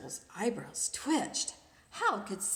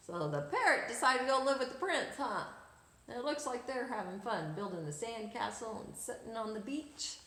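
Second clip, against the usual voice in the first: usual speaking voice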